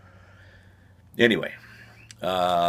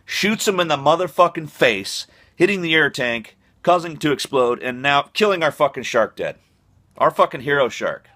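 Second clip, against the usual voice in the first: higher, louder, building outrage; indignant rant; 8 semitones higher, 5 dB louder than usual. Real, not acted. Comic defending the shark, ranting higher and louder in outrage. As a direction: rant it like an injustice was done.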